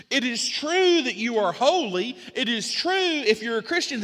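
high, loud, driving sermon cadence; full-force preaching. Real, not acted. Preacher listing believers' truths to the congregation, impassioned and loud.

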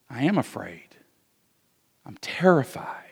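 quiet admission. Retold or imitated. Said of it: "Paul Washer looks at him and says".

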